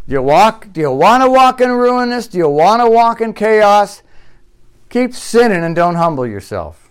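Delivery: loud, high rhetorical questions; fiery challenge. Acted. Pastor challenging listeners with pointed questions, loud and high. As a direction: challenge them hard.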